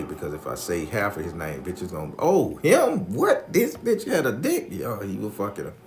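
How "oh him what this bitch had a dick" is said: loud, high-pitched shocked reaction; scandalized gossip. Retold or imitated. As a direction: mimic gossips blurting out disbelief. Others reacting to the name.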